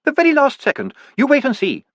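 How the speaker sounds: high, confident lady's voice; a fond wife, sure of her husband; Sybil, calmly sure. A narrator's reading. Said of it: "calmly soaping young Sam".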